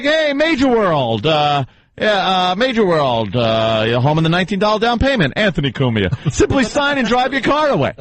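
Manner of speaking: high, put-on radio ad voice; mock commercial read. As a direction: parody a car ad with a name thrown in. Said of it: "you ever listen to commercials where it's like".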